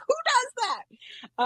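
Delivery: very high, incredulous mock outrage; appalled sober friend. Sober friend astonished at her having no coping, high mock outrage. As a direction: squeal it as an imitation of disbelief. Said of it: "this sober person being like".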